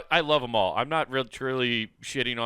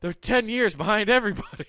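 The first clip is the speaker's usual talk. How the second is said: much higher, mock-exclaiming quote; mocking cartoon-style jeer; 10 semitones higher, 3 dB louder than usual. Co-host retelling South Park making fun of Iowa, mocking jeer. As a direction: poke fun in a raised voice.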